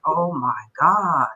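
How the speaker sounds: slow, stunned whisper of awe; awed oh my God. Retold or imitated. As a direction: let the realization sink in slowly. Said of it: "I was like".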